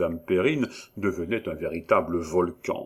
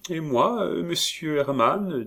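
high, slow, simpering; coy housekeeper playing shy; en minaudant; 8 semitones higher than usual. A narrator's reading. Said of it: "en minaudant". (voix minaudière de Perrine)

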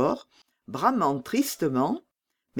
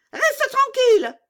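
much higher, loud threatening command; stern order; 6 dB louder than usual. Acted. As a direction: snap a warning at Gerda. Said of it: "dit la petite fille des voleurs".

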